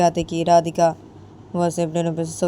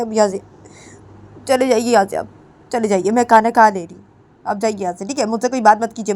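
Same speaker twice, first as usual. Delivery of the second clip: raised, hurt voice telling her to leave; upset dismissal; 4 semitones higher, 4 dB louder than usual. Acted. Daughter-in-law tells mother-in-law to leave, upset and raised. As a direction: push her away with words.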